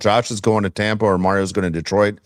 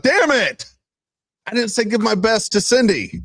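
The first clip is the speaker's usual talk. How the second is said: high-pitched acted-out frustration; mock exasperation; 13 semitones higher than usual. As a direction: cry out in comic self-reproach. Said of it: "most frustrating and embarrassing thing".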